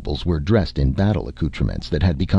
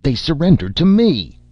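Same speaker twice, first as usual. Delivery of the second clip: much higher and louder, an angry noble shouting his claim; 11 semitones higher, 5 dB louder than usual. Acted. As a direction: yell it at the rival across the fire. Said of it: "Two arguing voices rose".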